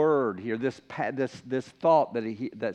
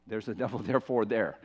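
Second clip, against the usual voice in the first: softer, lower, with a chuckle; amused aside; 5 semitones lower, 4 dB quieter, faster than usual. Real, not acted. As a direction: repeat it quietly and chuckle at the wordplay. Repeats his pun, then laughs.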